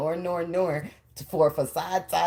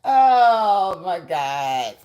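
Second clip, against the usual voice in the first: loud, drawn-out disbelieving Kevin Hart impression; 4 dB louder, much slower than usual. Reacting to a tarot card in a loud Kevin Hart voice. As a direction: stretch out the shock like Kevin Hart. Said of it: "In my Kevin Hart voice".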